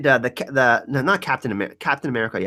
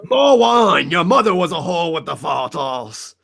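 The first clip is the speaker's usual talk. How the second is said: put-on drunken royal; 4 dB louder, slower than usual. Mock drunk royal demands.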